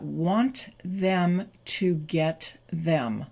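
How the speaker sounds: slow, word-by-word WANT THEM TO GET THEM; careful full forms. Shown as a demonstration. Teacher reads the written phrase to learners, slow full forms. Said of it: "This is written English".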